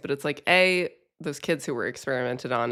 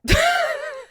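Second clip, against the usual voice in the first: high squealing laugh; 3 dB louder than usual. Real, not acted. Laughing at own mishap.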